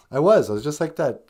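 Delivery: ordinary delivery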